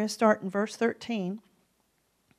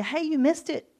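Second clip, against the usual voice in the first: imitating a gossip; 5 semitones higher, 7 dB louder than usual. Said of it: "somebody would have to tell you".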